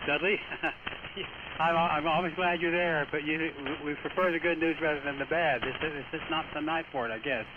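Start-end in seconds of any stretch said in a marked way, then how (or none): none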